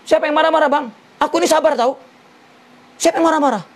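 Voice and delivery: a wife snapping back that she's patient; indignant wife. Retold or imitated. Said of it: "Istrinya langsung ngomong". (istri membantah suami dengan suara tinggi dan ketus)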